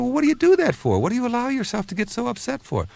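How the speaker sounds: high, exasperated mock disbelief; pleading with a hothead waiter, pitch way up; incredulous retold question. Asking the shouting waiter why he gets so upset, high and exasperated.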